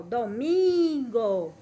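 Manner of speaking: "domingo" slowly, MIN drawn right out and loud; stretched stress on MIN. Shown as a demonstration. Teacher repeating the example for pupils, "domingo" with an exaggerated long MIN. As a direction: pull out and punch the middle of "domingo": do-MIIIIN-go. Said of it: "estiquei mais".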